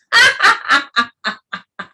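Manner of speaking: loud real laughter; hearty laugh. Real, not acted. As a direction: let the laugh burst out. Laughter exercise.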